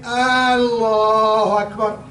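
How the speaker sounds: imitating the imam's drawn-out "Allahu Akbar"; imam's elongated takbir. Preacher imitating a friend imam's long takbir. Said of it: "He was saying".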